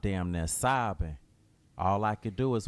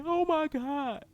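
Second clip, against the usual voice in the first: grieving mother crying at her son, much higher; mama sobbing. Acted. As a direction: wail out the blame.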